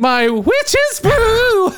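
high, slow witch croon. Pretend. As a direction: draw it out like a witch. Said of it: "sounds a lot like a witch".

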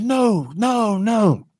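high-pitched retold protest; emphatic refusal. Retold refusal of weekly episodes.

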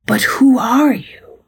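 high, breathy, slow mock amazement; hammed-up whispery bewilderment; baffled. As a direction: gasp the question like someone awestruck. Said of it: "English is baffled and asks".

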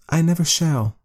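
high firm wife; woman's voice. A narrator's reading. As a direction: insist as the woman. Woman character voice.

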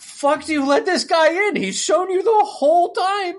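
high, laughing disbelief; incredulous rant. Retold or imitated. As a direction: ask it high, in exasperated disbelief. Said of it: "I'm like".

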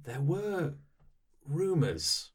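slow, measured joint reply; in unison. Acted. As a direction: say it together, grave and deliberate. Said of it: "as one they say".

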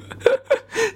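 high burst of real laughter; sudden laugh. Real, not acted. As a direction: break into a laugh at your own hunch. Laughs at own ghost hunch.

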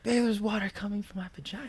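high-pitched imitation of a distant yell; imitating wife in background. Husband retelling wife's background yell, high-pitched mimicry. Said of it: "I hear in the background just like".

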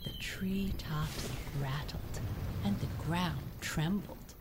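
ordinary delivery, nothing marked

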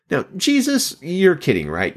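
high-pitched, acted disbelief; incredulous. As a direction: question it as if it must be a joke. Mock objection addressed to Jesus.

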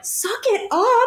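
high, drawn-out incredulous repeat, rising at the end; horrified echo. Retold or imitated. Retelling a shocked reply to a would-be tour manager, horrified. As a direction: throw it back in appalled disbelief. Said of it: "I was so horrified".

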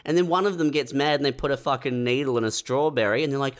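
ordinary talk, nothing marked

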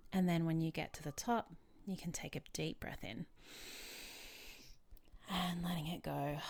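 calm, unhurried instructions with long pauses; guided meditation. Real, not acted. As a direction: lead a relaxation exercise gently.

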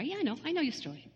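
soft and quick, a hushed "I know"; Jesus quietly telling the woman he knows her story, hushed. Retold or imitated. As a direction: say it gently and fast, almost under breath.

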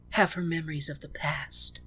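soft, hushed, dramatic whisper; mock-wistful whisper. A narrator's reading. Host narrating the lovers' parting, mock-wistful whisper. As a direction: breathe it out like a tragic romance.

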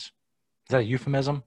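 normal talking voice

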